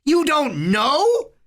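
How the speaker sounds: high, stretched, incredulous yell; angry, drawn-out challenge. A narrator's reading. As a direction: hurl it at him with the pitch shooting up. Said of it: "Harry yelled".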